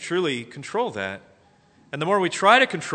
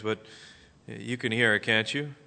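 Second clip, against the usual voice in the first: ordinary delivery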